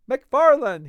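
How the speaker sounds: loud, high call of a name, like a herald; loud hail. A narrator's reading. Fetz hailing the doctor on the stairs, loud herald call. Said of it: "he said somewhat loudly".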